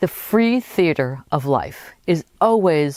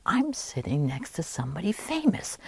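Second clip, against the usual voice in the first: imitated awed thought, much softer; awed inner thought; 9 dB quieter than usual. Woman on the train thinking of her seatmate, awed and hushed. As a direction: think it quietly, as if to yourself. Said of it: "this woman starts thinking".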